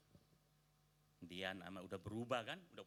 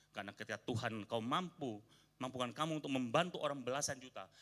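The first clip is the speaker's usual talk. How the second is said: normal talking voice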